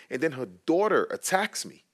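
ordinary talk

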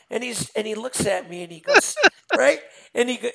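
usual speaking voice

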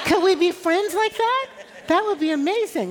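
laughing. Real, not acted. Laughing invite to visitor.

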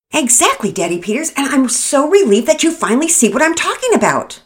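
high, loud, emphatic relief; finally, at last he sees; wife relieved her husband agrees. Acted.

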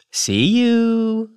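sing-song farewell, "you" held on a high note; faux-formal high-note goodbye. A narrator's reading. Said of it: "raising the last part a high note".

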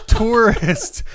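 "Tourist" laughed, rising; giggling "Tourist" again; laughing repeat. Shown as a demonstration. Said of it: "I almost said terrorist".

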